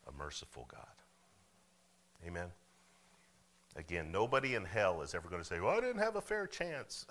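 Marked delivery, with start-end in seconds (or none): 5.6-7.1 much higher-pitched mock protest; mock whining excuse. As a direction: put on a high-pitched complainer's voice.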